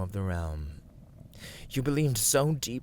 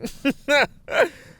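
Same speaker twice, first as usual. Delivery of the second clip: villain laugh; 10 dB louder than usual. Acted. Villain mocks listener's disbelief.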